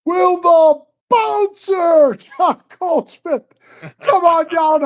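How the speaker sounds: high, drawn-out ring announcer call; slow, booming mock introduction. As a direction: stretch the names out like a fight announcer. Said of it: "And then they go".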